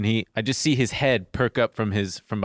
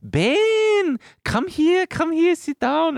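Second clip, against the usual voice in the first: boss's wife; 5 dB louder, much slower than usual. Retold or imitated. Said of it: "she would go".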